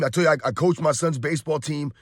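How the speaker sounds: normal talking voice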